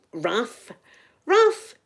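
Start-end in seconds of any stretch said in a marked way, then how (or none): 1.3-1.8 a high, loud call of the dog's name. Real, not acted. Spotting the dog mid-story, high loud call.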